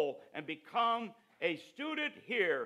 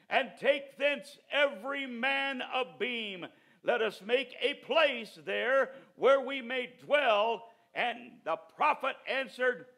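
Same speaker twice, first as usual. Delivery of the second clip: louder preacher's voice that builds to shouts; full-force preaching; 7 semitones higher, 9 dB louder than usual. Acted. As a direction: preach it to the whole room. Preaching to congregation.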